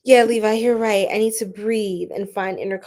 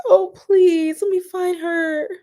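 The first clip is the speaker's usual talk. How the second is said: teary, pleading, much higher; emotional plea; 8 semitones higher, 5 dB louder, slower than usual. Real, not acted. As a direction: beg it through real tears. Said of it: "I'm getting emotional".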